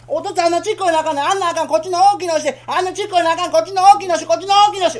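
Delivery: put-on high, fast childish whining; child demanding. Boy whining at father for the big dango.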